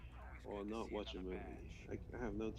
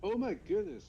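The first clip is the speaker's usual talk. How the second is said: surprised exclamation; 9 semitones higher, 6 dB louder than usual. Real, not acted. Excited at free game find.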